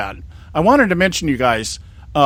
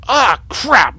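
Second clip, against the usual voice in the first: cleaned-up outburst; 7 semitones higher than usual. Retold or imitated. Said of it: "but that's not how I said it".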